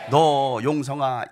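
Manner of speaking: ordinary delivery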